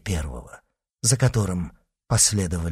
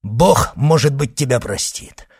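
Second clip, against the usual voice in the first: loud, raised, forceful retort; vengeful refusal; 5 semitones higher, 6 dB louder than usual. Acted. Son refuses his mother's killer, vengeful. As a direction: declare it with hard force.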